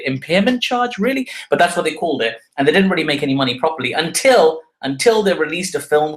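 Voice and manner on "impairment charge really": high, disbelieving repeat of impairment charge; incredulous. Shown as a demonstration. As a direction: echo the phrase Impairment charge as if you can't believe it. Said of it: "which is a bit weird".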